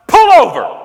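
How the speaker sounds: loud, high urgent yell; urgent shout. Retold or imitated. Steph in the Lyft, shouting at the driver urgently. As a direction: yell at the driver to stop now. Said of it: "she's like".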